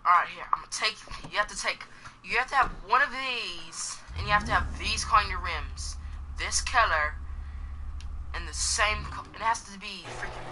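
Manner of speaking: playful put-on English accent. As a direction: chat along in a jokey English accent. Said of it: "I'm just gonna talk in this English voice".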